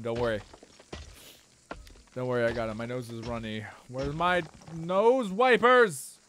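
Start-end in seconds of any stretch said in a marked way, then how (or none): 3.9-6.2 silly drawn-out voice. Pretend.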